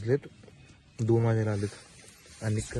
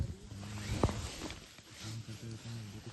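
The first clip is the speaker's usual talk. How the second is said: hushed storytelling; 13 dB quieter than usual. Real, not acted. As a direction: tell it softly to spook them. Ghost story told to scare.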